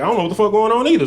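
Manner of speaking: high, fast, baffled squeak; bewildered. Retold or imitated. Comedian reacting to Scooby-Doo boxers, high and baffled. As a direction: blurt it out, totally lost.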